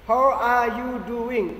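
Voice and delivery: each word said slowly and fully, How are you doing; slow careful demo. Shown as a demonstration. Teacher shows the full slow form of the greeting.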